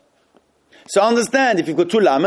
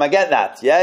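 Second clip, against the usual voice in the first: usual speaking voice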